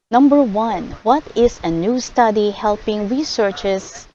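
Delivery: normal talking voice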